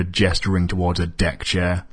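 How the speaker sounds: ordinary delivery, nothing marked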